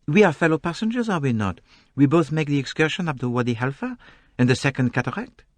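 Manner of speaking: polite Belgian detective asking a fellow traveller; Poirot voice. A narrator's reading.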